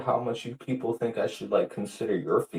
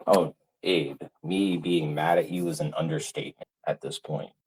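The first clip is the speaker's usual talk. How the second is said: ordinary delivery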